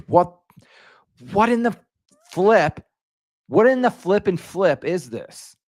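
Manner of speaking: high, loud indignant disbelief; what on earth, half-joking outrage; outraged disbelief. Real, not acted. Outrage at broken notifications.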